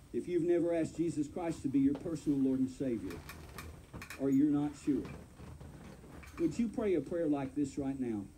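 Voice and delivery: ordinary talk, nothing marked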